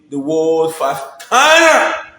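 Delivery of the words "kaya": hype shout. Real, not acted.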